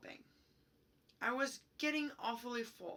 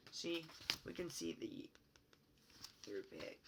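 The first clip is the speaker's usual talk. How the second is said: hushed aside over the picture; whisper; 4 semitones lower, 7 dB quieter than usual. Real, not acted. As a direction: whisper as you point to the page.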